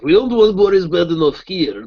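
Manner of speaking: loud, high, slow cartoon Russian accent; Boris Badenov. Shown as a demonstration. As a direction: lay the Russian accent on thick. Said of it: "they wanted a Russian accent".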